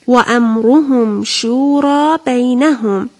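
slow, drawn-out Arabic recitation; Quran recitation. Shown as a demonstration. Teacher giving children a phrase to memorise, slow recitation. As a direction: recite it with lengthened vowels.

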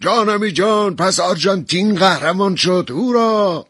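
high cheering yell; overjoyed shout of victory; joyful shout. A narrator's reading. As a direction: whoop in triumph. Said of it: "با خوشحالی فریاد زد".

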